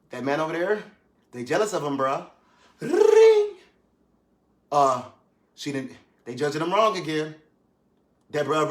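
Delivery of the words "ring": loud high mock ringtone; phone ring. Preachers keep calling, a louder high mock ring.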